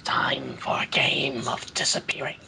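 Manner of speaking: slow, low whispered villain line; menacing villain whisper. Retold or imitated. Mocking the Horned King as a Care Bear villain, slow menacing whisper. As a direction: hiss it like a gloating cartoon villain. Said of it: "see the Horned King just go out going".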